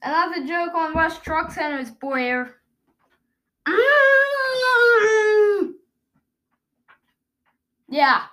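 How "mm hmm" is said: humming with mouth taped shut; muffled hum through tape. Real, not acted.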